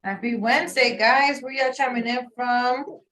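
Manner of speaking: ordinary delivery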